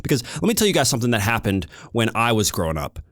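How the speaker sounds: normal talking voice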